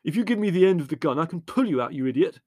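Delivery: loud, high, hurried shout; exasperated shouting at a fool. A narrator's reading. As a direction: shout instructions impatiently. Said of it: "shouted Leslie".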